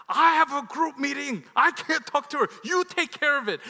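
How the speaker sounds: loud, high irritated shouting; hammed-up shouted refusal; exasperated wife. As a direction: imitate a fed-up wife yelling down the phone. Wife snapping on the phone.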